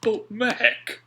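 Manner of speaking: Alf, eager sailor. A narrator's reading. Alf pleading with Mac, high eager voice.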